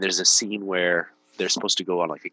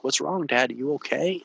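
put-on high, worried child voice; little boys' voice; 7 semitones higher than usual. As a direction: imitate his little sons asking if he's okay. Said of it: "they're like".

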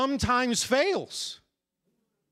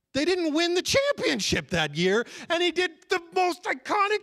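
high, exasperated disbelief; incredulous rant; 8 semitones higher than usual. Real, not acted. Preacher questioning Kobe's shout over one game, high incredulous sputtering. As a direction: climb higher until the words stumble.

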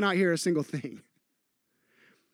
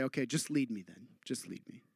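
quiet surrender prayer; 7 semitones lower, 10 dB quieter than usual. Retold or imitated.

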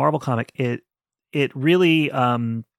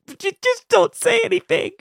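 much higher, squeaky mock voice of herself; mock high squeal. Telling her co-host how she held back spoilers, high squeaky mock voice. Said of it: "I was like".

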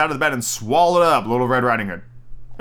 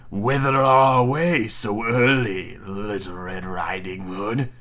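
wolf voice; 4 semitones lower, much slower than usual. Acted. Wolf questions the girl.

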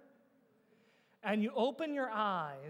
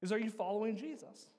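usual speaking voice

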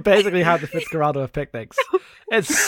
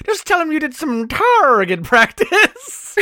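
slow, higher, tipsy Grisby line; quoting Grisby; 9 semitones higher, slower than usual. Retold or imitated. Retelling a drunk character's line, slow and tipsy. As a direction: drawl it like a giggly drunk. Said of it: "He's like".

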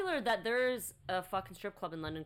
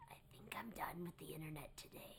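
whispering; 5 semitones lower, 15 dB quieter than usual. Retold or imitated. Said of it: "I was like".

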